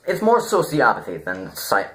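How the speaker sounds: usual speaking voice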